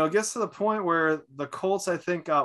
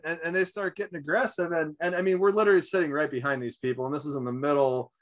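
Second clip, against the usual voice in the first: normal talking voice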